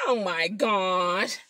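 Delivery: ordinary talk, nothing marked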